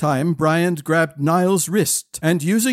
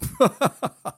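high burst of laughter; hearty laugh; 8 semitones higher than usual. A narrator's reading. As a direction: laugh at their stunned faces. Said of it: "causing the prince to laugh".

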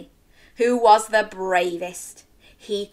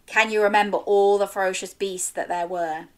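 usual speaking voice

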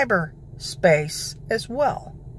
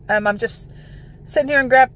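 normal talking voice

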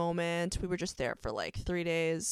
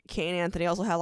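ordinary talk

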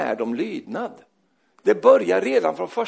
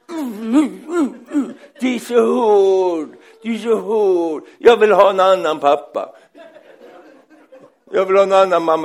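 mock crying child; 3 semitones higher, 8 dB louder than usual. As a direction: bawl like a kid wanting new parents. Child's tearful tantrum at parents. (överdrivet gråtande, bölande barn)

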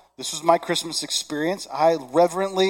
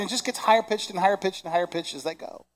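ordinary talk

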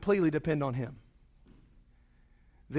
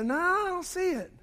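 unseeing friend; 10 semitones higher than usual. Retold or imitated. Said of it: "And they go".

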